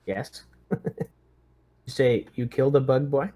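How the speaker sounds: ordinary delivery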